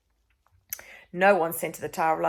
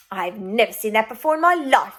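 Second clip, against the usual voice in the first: louder indignant denial; protesting; 4 semitones higher, 9 dB louder than usual. Acted. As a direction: deny it with outrage. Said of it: "protested the queen".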